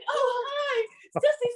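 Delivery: very high, flustered fan voice; star-struck squeal. Retold or imitated. Fan trapped in the door facing the star, squealing. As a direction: gush helplessly in a retold high squeak.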